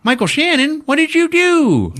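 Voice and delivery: hammed-up, very high squealed scolding; dismayed secretary. Secretary reacting to the boss's spilled water, shrill mock dismay.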